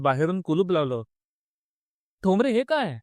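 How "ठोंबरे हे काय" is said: high-pitched cry of protest, little added volume; mild shout. A narrator's reading. Narrator calling to Thombre as he is locked in, mildly shouted. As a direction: call out in startled protest, higher. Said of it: "मी ओरडलो".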